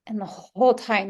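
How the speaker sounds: ordinary delivery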